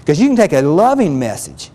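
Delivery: ordinary talk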